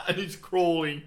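high and loud through laughter; cracking up mid-sentence; laughing. Real, not acted. Recalling the crawling scene with his mate while laughing, high and loud.

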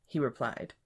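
usual speaking voice

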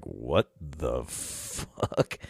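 low, soft, long drawn-out words; stunned retold mutter; slow disbelief. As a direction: stretch each word in quiet bewilderment. Retelling his slow disbelief.

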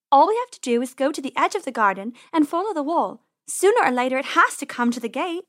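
lightly voiced plucky rabbit; brave sister. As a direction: lay out the plan to rally a brother. Rabbit sister explains her brave plan.

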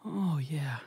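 whispered fake moan of pleasure; very quiet mock moan. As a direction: breathe out a sly, hushed moan. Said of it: "so very quietly, I just start going".

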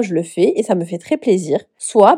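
usual speaking voice

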